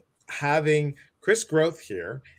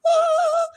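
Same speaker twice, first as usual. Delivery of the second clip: a very high, loud shriek of joy; ecstatic squeal; 7 dB louder than usual. Retold or imitated. Host reliving winning a speaking slot, ecstatic high squeal. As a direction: squeal "Ah!" like you just won. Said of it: "two years later going".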